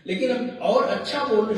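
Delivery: normal talking voice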